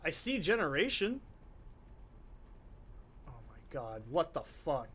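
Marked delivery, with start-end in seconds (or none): 3.2-4.9 low, soft disbelief; dismayed mutter. Real, not acted. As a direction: mutter it to yourself. Muttering in dismay at being stuck.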